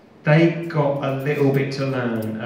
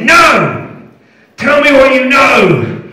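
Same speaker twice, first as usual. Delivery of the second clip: much higher, louder furious demand; furious shout; 11 semitones higher, 11 dB louder, slower than usual. Retold or imitated. Interrogator yelling at the prisoner, furious shout. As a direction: scream it at him. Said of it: "And he said".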